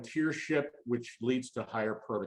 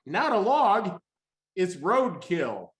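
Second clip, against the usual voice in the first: higher and louder, then slow on roadkill; spring the surprise, then land it slowly; excited reveal; 5 semitones higher, 4 dB louder, slower than usual. Real, not acted. Punchline reveal of the photo.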